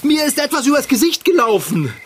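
high panicky voice; frantic and disgusted; panicked. Acted. Panicked after creature on face.

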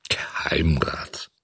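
slow, whispery low echo; gruff. A narrator's reading. Director weighing the title offered, gruff. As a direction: chew over the title, slow and hushed. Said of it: "gab dieser barsch zurück".

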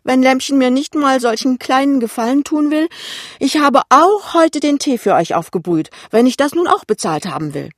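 high, loud, offended mother voice; wounded mother keeping up her complaint; aggrieved mother. Acted. Mother argues over money.

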